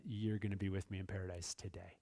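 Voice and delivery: soft, low, unhurried reverent promise; hushed Jesus promise. Retold or imitated. Pastor voicing Jesus promising the thief paradise, softly. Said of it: "And Jesus said".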